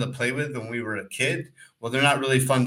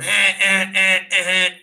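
honking horn noise, high and loud; imitated car horn; 7 semitones higher, 5 dB louder than usual. Cyclist mimicking the trucker honking at him, loud horn beeps. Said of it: "starts playing on the horn".

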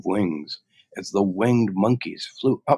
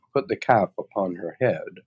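ordinary delivery, nothing marked

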